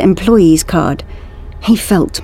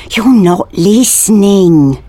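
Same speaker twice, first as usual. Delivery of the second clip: slow, tense hiss, a little higher; hissed insistence; 4 semitones higher, 4 dB louder, slower than usual. A narrator's reading. Lucas to his friend who won't listen, hissed. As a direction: press each word out at the friend, hissing. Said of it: "hissed Lucas".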